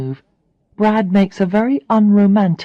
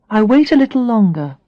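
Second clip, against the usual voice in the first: ordinary delivery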